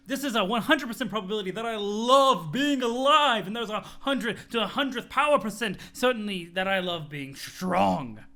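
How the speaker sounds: a gushing gym bro who is thrilled to be alive; excited muscle bro. Acted. Muscle bro raving to Dirk about strong feelings. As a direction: gush about the thrill of being strong, laughing.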